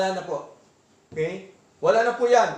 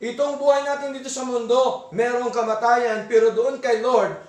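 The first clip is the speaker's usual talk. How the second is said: normal talking voice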